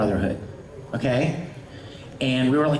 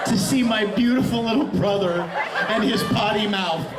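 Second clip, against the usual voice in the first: laughing; 6 semitones higher than usual. Real, not acted. Laughing while recalling the outburst.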